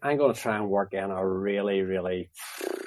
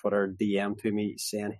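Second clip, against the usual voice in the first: usual speaking voice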